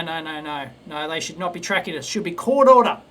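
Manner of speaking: ordinary talk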